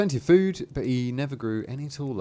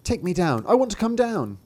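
whiny little voice, climbing higher; 8 semitones higher, 3 dB louder than usual. A narrator's reading. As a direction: demand it like a whining small child. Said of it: "he's a bit whiny".

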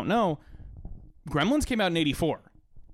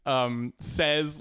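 usual speaking voice

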